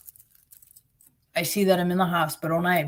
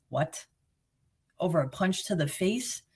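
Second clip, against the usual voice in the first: soft, stunned disbelief; 8 dB quieter than usual. Retold or imitated. Woman hearing she'll be life flighted, soft stunned disbelief. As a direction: drop the voice right down, can't believe it.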